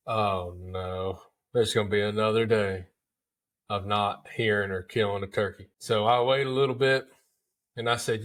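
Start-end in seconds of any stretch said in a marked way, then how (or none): 0.1-5.7 low, soft mock despair; mock dejected groan. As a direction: sigh it out like the day is already lost. Dreading another empty hunt.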